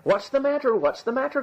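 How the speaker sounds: high-pitched cries of alarm; crying out. A narrator's reading. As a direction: call out like a startled crowd. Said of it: "cried they".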